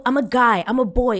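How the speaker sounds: loud, high mimicked boast; puffed-up mock protest; self-important assertion. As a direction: imitate a boy demanding respect. Alex asserts himself as a boy.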